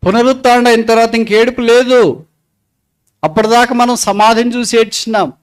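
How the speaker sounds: high, driving sermon delivery; forceful preaching. Real, not acted. Preacher explains graveside weeping to the congregation, forceful and high.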